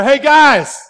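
loud hail across the water, voice pushed out; calling from shore. Retold or imitated. Jesus hails the fishermen from the shore, loud call. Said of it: "he yells out from the shore".

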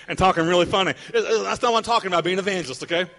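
ordinary talk

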